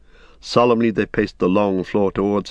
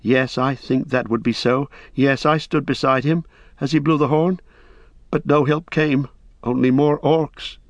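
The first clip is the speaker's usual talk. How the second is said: higher, eager hobbit voice; a young hobbit recalling the fight; young hobbit Pippin; 5 semitones higher than usual. Acted. Pippin answers Denethor.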